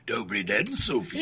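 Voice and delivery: put-on Slovak accent, low and relaxed. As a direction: greet her in a Slovak accent. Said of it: "that's my Slovak voice".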